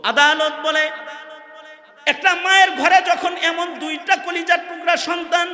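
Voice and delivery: high, loud sermon cry; ringing high-pitched exhortation; impassioned preaching. Real, not acted. Preaches at full force.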